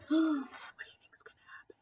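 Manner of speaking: hushed suspense whisper to the listener. Real, not acted. Reader asks child what happens next, whispered. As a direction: lean in and whisper the question.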